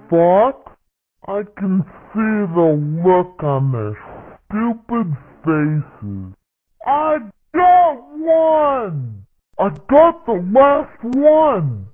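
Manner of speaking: animated battle-radio sidekick lines; game character voice. Acted. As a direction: act out short cartoon combat callouts.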